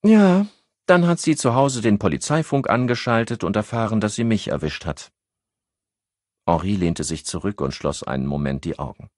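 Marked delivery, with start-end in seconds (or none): 0.1-5.1 Annette, higher voice. A narrator's reading.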